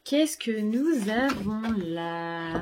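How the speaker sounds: slow, drawn-out playful wonder; teasing curiosity. Real, not acted. Booktuber teases viewers about the next item, drawn out. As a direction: tease the reveal.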